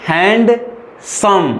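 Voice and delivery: हैंडसम said very slowly as two separate beats; split into syllables. Shown as a demonstration. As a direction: draw हैंडसम out, hand-sum. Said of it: "हैंडसम बोलिए तो".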